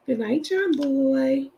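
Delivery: slow, drawn-out Waltons farewell; playful slow nod to The Waltons; Waltons sign-off. Pretend. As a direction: stretch it out like the TV family.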